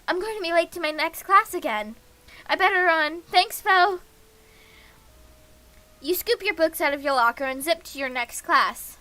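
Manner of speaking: ordinary talk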